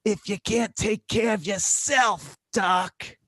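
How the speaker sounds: imitating a blunt old patient; old lady scolding. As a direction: call out the doctor. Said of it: "she goes".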